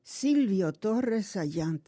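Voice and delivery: slow careful Spanish name; "Silvio Torres Ayant" said slowly in Spanish; Spanish pronunciation. Real, not acted. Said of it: "Oh, wasn't that beautiful?".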